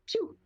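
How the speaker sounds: a high, playful laser pew; mock zap sound. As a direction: fire off a cartoon blaster sound. Describing the spell's orb firing.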